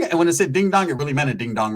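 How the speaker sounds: normal talking voice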